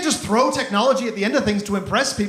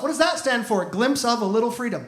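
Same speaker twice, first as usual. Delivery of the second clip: normal talking voice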